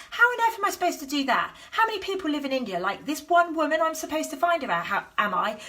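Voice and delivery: high, exasperated disbelief; mock-overwhelmed protest, rushing; ego panic. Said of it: "going straight into my ego".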